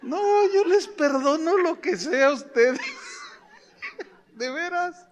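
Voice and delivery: high laughing joke to the congregation; playful laughing aside; laughing tease. Real, not acted. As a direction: tease them while laughing. Joking with congregation.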